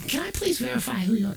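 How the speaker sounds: high, bright phone-rep voice; sugary operator on the phone line; customer service rep. Acted. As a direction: put on a chirpy call-center voice. Comic acts out customer service call.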